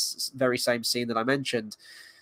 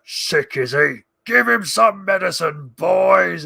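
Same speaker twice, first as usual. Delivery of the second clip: loud, raised orc shout; 4 semitones higher, 6 dB louder, slower than usual. Acted. Orc to his boys over sick Merry, loud orc jeer. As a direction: call out to the gang as a mocking orc. Acts out film orc's lines.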